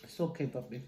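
gentle soft reassurance; calm him softly. Real, not acted. Comforting sick grandson.

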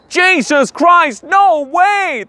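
very high, slow drawn-out wail. Acted. Colleagues wailing at the idea of a fair Ireland, high slow wail. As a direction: wail it in horror. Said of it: "They're wailing".

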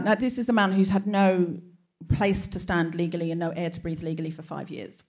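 ordinary talk

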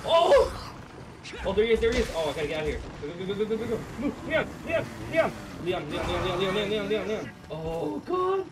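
very high, very loud shrieks; terrified screaming. Real, not acted. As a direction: scream while being chased. Chased by chainsaw enemy.

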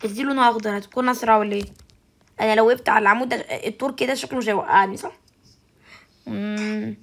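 ordinary delivery

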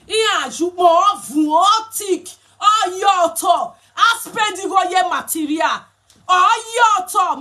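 hammed-up telling-off; mock scolding. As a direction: scold like an annoyed elder over the cloth. Acted scolding over the materials.

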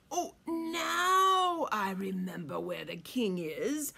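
slow, drawn-out crafty voice; sly smile. A narrator's reading. Fox pretending to remember the king, slow and sly. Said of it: "said with a sly smile".

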